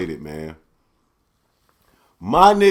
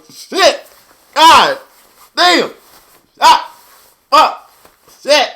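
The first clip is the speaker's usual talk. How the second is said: short yelps, much higher and louder, each one a wince; pained fight-fan cries; 14 semitones higher, 9 dB louder, much slower than usual. Retold or imitated. Fan reliving the fight yelps at each blow. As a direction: cry out at every punch landing like it hurts you. Reacting to fight blows, reenacted.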